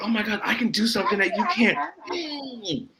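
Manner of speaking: high, excited retold boast; high-pitched re-enacted triumph; gleeful one-upping. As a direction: crow it like a delighted kid. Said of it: "I was so happy".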